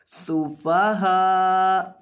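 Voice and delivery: सुफहा with a hissing, whistled s; slow सुफहा with a whistling s; whistling seen. Shown as a demonstration. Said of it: "इसमें सीटी पैदा करनी है".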